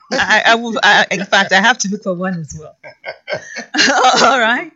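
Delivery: loud, giggly reply; laughing off a compliment. Real, not acted.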